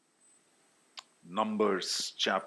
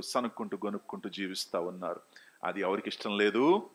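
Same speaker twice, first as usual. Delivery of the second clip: ordinary delivery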